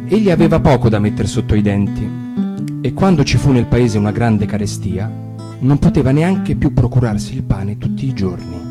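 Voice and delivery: usual speaking voice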